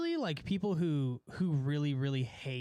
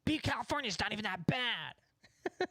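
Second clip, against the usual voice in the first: defensive Californian mimic; 6 semitones higher than usual. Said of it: "where it's like".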